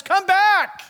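loud high call. Retold or imitated. Preacher retelling calling his dog back, loud call. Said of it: "And the other one is".

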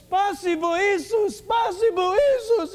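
high, loud imitation of a grateful babushka; overcome Russian grandma. Russian grandma grabs the preacher to thank Jesus, overcome. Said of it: "And she starts crying".

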